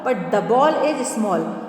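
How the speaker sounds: very slow, each word apart; very slow model sentence. Shown as a demonstration. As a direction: dictate it word by word. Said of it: "this is a ball".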